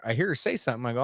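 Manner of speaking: normal talking voice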